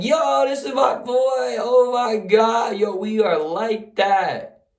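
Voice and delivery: high, drawn-out celebratory holler; ecstatic screaming. Acted. Teammate Kevin celebrates the winning pong shot, screaming ecstatically. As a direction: whoop it out in stretched, triumphant disbelief. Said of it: "Kevin is screaming".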